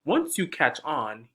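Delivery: ordinary talk, nothing marked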